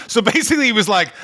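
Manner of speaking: ordinary talk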